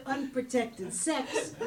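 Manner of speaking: ordinary talk, nothing marked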